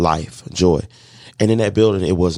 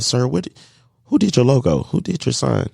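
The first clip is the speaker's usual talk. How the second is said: eager question; 6 semitones higher than usual. Retold or imitated. Eagerly asking the tire man.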